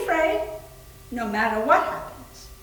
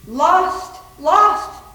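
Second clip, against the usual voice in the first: louder, higher cries of lost, rising each time; repeated lost; 3 semitones higher, 8 dB louder, much slower than usual. Acted. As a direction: wail it like a lost spirit. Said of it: "They love to repeat themselves".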